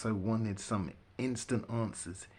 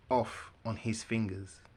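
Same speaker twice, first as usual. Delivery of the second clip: ordinary delivery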